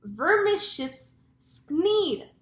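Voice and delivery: high, loud, slow horrified cry; drawn-out storybook dread; cried in horror. A narrator's reading. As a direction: recoil aloud at a monster. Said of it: "cried the chief of police".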